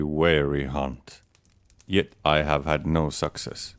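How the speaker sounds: ordinary delivery, nothing marked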